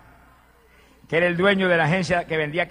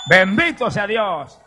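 loud, drawn-out blessing; fervent shout of praise; exclaimed praise; 5 semitones higher, 6 dB louder, much slower than usual. Real, not acted. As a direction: call it out slowly to the congregation.

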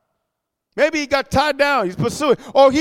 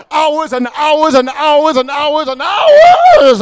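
"hours" repeated, the last stretched long and loud; drawn-out emphasis; 3 semitones higher, 5 dB louder, much slower than usual. Real, not acted. Dramatizes how long they cried out.

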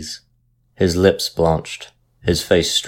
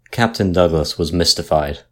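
usual speaking voice

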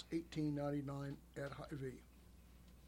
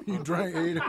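laughing; 3 semitones higher, 5 dB louder than usual. Real, not acted. Jokes about the sausage being eaten.

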